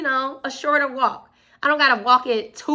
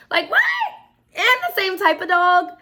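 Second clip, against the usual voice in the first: surprised; 8 semitones higher, 4 dB louder than usual. Real, not acted. Amazed at sister's dog luck.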